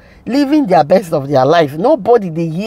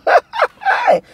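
high squealing laugh. Real, not acted. Vlogger cracking up at her own joke, high squealing laugh. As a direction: burst into a high-pitched giggle. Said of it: "I want to laugh again".

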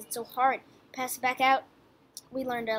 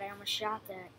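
ordinary talk, nothing marked

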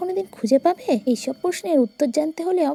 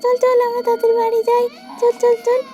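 much higher, hurried and urgent; anxious madwoman; 6 semitones higher, faster than usual. Acted. Madwoman hurrying the crying child away, urgent. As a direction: rush the child home, fretting.

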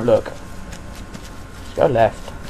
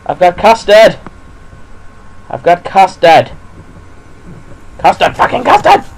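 loud raised pleading shout; frantic shout climbing higher; desperate calling out; 5 semitones higher, 6 dB louder than usual. Real, not acted. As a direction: yell it at the monster, rising to panic. Calling out to pursuing creature.

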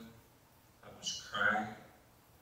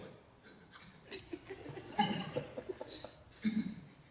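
breathy laugh. Real, not acted. Laugh at the shaking bed.

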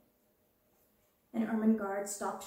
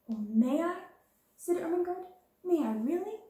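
loud and high delighted plea; thrilled; 8 semitones higher, 6 dB louder than usual. Acted. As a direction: beg eagerly, voice leaping up. Ermengarde thrilled to hold doll.

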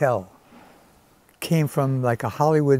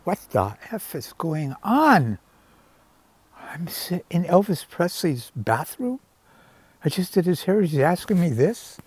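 higher-pitched, mildly panicked retold self-talk; inner panic; 6 semitones higher than usual. Hairdresser questioning himself in [name]'s bathroom, retold mild panic. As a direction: replay a bewildered private freak-out, pitch climbing. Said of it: "I started to panic within myself".

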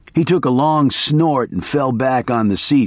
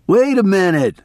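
a lightly raised, higher call; yelled; 8 semitones higher than usual. A narrator's reading. Narrator's mild yell after the fleeing kid. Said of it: "I yelled".

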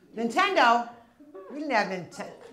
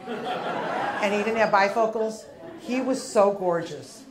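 normal talking voice

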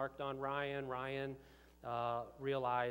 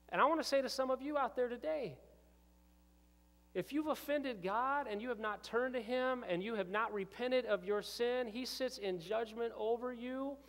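higher and louder, pleading with the crowd; fervent preaching; 8 semitones higher, 3 dB louder than usual. Acted. Pastor urging the congregation to repent, preaching loudly. Preaching rising to full force.

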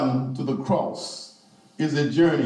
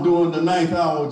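usual speaking voice

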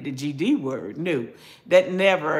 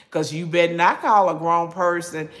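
ordinary talk, nothing marked

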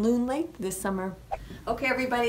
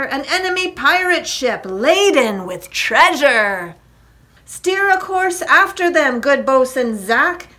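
excited captain, raised pitch and volume; gleeful cry; 6 semitones higher, 10 dB louder than usual. A narrator's reading. Jack orders a chase after the treasure ship, gleeful cry. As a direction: relish the find, then give the order. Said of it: "cried Jack with a chortle of pleasure".

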